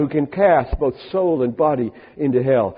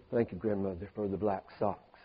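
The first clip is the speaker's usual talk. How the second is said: low, much softer grudging mumble; sullen child; 7 semitones lower, 15 dB quieter than usual. Retold or imitated. Boy thanking grandmother for unwanted socks, grudging low mumble. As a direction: mumble it like a kid forced to say thanks.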